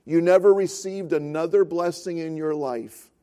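normal talking voice

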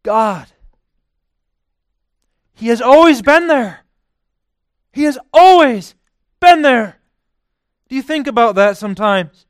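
high, loud, impassioned preaching; fervent preacher at full force. Acted. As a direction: drive it home from the pulpit.

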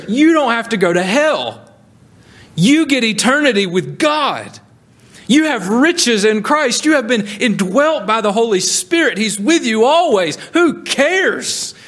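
high, ringing preacher's voice, pressing each phrase; full-force preaching. Acted. Preacher urging the congregation to grateful joy, ringing and fervent. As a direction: preach it out with fervour.

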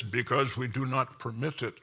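a lightly voiced stern bear, cool and firm; stern Russian bear. Cartoon bear answering Uncle Sam, calm and firm. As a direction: answer coolly, like a bear that allows no trouble. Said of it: "the Russian bear says".

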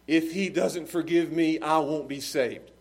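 ordinary talk

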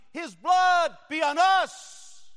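crowd shout. Retold or imitated. Preacher recalling the crowd's cry, slow high shout.

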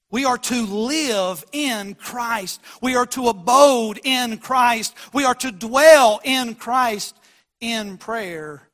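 slow, deliberate words; slow weighty sermon lines; slow emphatic preaching. Acted. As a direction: spell each phrase out for them.

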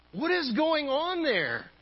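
high-pitched alarmed demand. Acted. Boss questioning the child on the phone, alarmed. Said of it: "The C E O is now alarmed".

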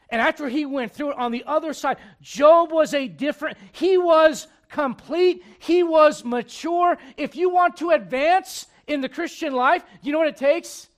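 loud, high, driving sermon voice; full-force preaching. Acted. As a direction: preach it out to the whole church. Preacher builds to the point.

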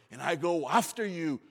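David shouting his vow at Goliath; defiant shout. Acted. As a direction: hurl it at the giant.

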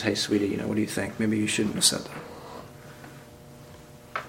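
soft, slow, hushed suggestion; voicing a tender wife, almost whispered; gentle wife. Wife gently correcting her husband, soft and hushed. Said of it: "his wife comes to him and says".

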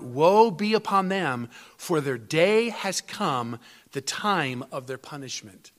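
slow, weighty reading of prophecy; measured pulpit reading of scripture; solemn scripture reading. Acted. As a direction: read the verses like a pronouncement of doom. Quotes Jeremiah's prophecy.

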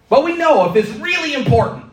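very high, loud burst. Real, not acted. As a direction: jolt the listeners to attention. Raises voice to mark importance.

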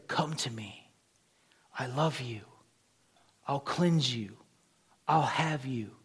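soft hush of a tender call; whispered invitation. Retold or imitated. The Spirit calling Bunyan, a soft whispered invitation. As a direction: breathe it like a gentle summons.

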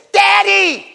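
high-pitched excited shout for a parent; loud, squealing kid's call; little kid calling. Retold or imitated. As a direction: imitate a small child yelling for Dad. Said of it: "I have little kids".

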